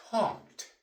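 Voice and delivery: "Talked" said high and clear as one syllable; model word. Shown as a demonstration. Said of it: "We say talked".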